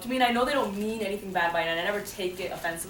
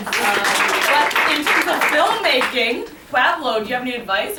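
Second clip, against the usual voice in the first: loud, higher, drawn-out handover; calling out; 6 semitones higher, 13 dB louder, slower than usual. Real, not acted. Host hands the filmmaking question over to Pueblo, calling out. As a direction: call across to a colleague, stretching the words.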